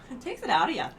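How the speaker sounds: laughing, a hint of a Canadian accent; a laughing, mild Canadian lilt; mock Canadian accent. Shown as a demonstration. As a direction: repeat it, lightly Canadian and laughing. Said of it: "sounded so Canadian".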